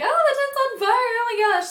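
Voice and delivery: high, loud panicked squeal; panicked alarm. Retold or imitated. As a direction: shriek it as the tent catches fire. Drunk friends fleeing burning tent.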